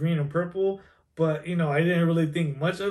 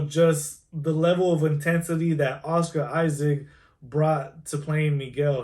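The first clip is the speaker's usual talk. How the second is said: ordinary talk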